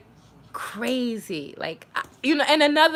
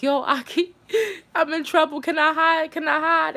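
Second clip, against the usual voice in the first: higher-pitched urgent begging, imitated; retold panicked plea; 6 semitones higher than usual. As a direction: plead quickly for a hiding place, acting it out.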